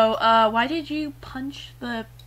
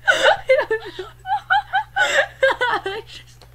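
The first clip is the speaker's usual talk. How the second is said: high laughing words; 4 dB louder, slower than usual. Real, not acted. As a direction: giggle through it. Giggling fit on camera.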